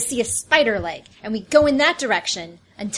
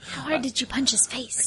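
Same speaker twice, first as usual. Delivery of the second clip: whisper; 3 semitones higher, 6 dB quieter than usual. Real, not acted. Whispered aside at the table.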